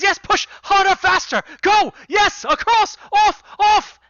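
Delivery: high, loud shouted urging; shouting encouragement. Acted. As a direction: drive the crew on with shouts. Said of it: "and he says".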